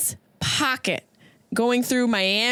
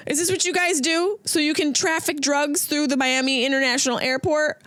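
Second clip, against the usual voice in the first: normal talking voice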